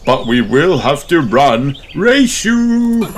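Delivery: rising to a loud high dare; playful challenge. Acted. Daddy challenges Mummy, high. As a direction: dare her and dash off.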